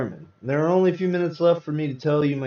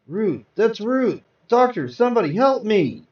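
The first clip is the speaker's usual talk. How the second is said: rising panic, higher and louder toward a cry for help; frantic call; 8 semitones higher, 5 dB louder than usual. Acted. Husband in a hospital bed calling for help, frantic and loud. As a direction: shout for the doctor as it dawns who is in the next bed. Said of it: "my frantic call".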